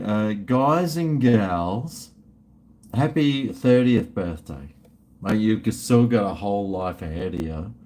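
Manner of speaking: normal talking voice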